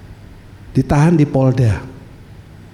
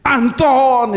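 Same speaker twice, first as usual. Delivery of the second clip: calling out a name; 12 semitones higher than usual. Shown as a demonstration. Preacher demonstrating calling a friend's name in fear, high call. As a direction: call the name much higher, with a pull. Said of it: "jangan gini".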